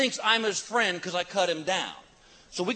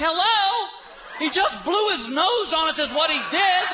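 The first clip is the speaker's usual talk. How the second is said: exasperated; 8 semitones higher than usual. Retold or imitated. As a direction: scold them in disbelief. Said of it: "I'm like".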